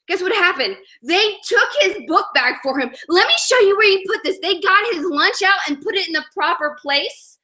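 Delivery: ordinary talk, nothing marked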